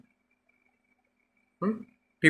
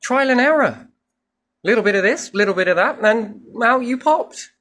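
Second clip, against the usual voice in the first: much higher, louder voice of a bemused creator; a creator's voice pitched way up, half-joking; voicing the divine; 10 semitones higher, 3 dB louder than usual. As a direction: play God shrugging, high-pitched and offhand. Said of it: "It'd be like".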